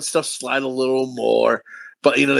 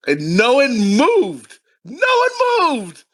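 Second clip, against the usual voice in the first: slow, high incredulous repetition; drawn-out disbelief; 9 semitones higher, much slower than usual. Real, not acted. Comic telling friends nobody left after his warning, incredulous. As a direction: stretch every word, amazed at the crowd. Incredulous punchline repeated thrice.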